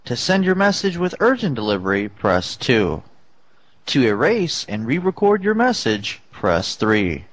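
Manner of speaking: automated prompt, press options dropping low; answering machine voice. Pretend. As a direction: recite menu like a robot system. Fake voicemail menu.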